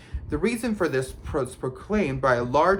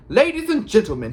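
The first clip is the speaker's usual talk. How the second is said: high, loud showman's address; loud announcement; 7 semitones higher, 7 dB louder than usual. A narrator's reading. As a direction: call a crowd to attention. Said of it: "he said loudly".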